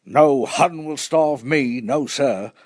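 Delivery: butcher. A narrator's reading. Butcher to his queue of customers, lightly voiced, defiant. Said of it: "I heard the butcher tell".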